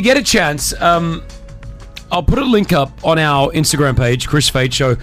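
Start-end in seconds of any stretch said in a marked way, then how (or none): none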